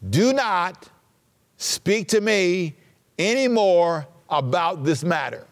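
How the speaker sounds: slow, spaced-out stern order; stern command. Retold or imitated. Preacher voicing the Lord silencing Moses, slow and stern. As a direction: lay down the order firmly, pausing between phrases.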